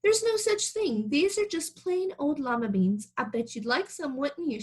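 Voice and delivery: high teasing granny voice; sweet old woman. Acted. Old woman tempting Camilla with beans, high coaxing voice. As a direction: coax her like a playful granny.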